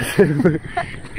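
a high, rising laugh. Real, not acted. Arriving at the village canal, a high rising laugh.

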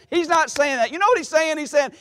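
ordinary talk, nothing marked

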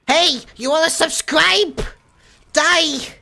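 high, loud mock-aggressive sales shout; forceful advertisement. Streamer advertising with a shotgun, forceful high shout. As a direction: yell the pitch like an armed threat. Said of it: "Forceful advertisement with my shotgun".

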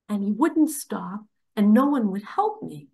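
ordinary delivery

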